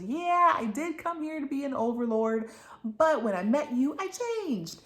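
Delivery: high, loud, insistent admission; acted pleading confession. Man pleads in an acted quarrel.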